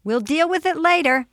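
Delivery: high, loud mother's call; busy mom raising her voice; calling back. A narrator's reading. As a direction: call back to a child upstairs. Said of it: "Peg called back".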